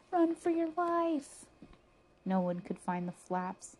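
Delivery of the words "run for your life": high, drawn-out alarm; storybook panic, stretched out; panicked cry. A narrator's reading. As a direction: cry out a slow, high warning. Story character warns of approaching aliens.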